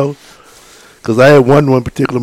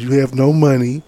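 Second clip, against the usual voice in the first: normal talking voice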